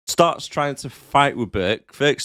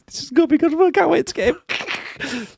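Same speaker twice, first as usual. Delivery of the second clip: nun villain voice. Retold or imitated. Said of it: "She's like".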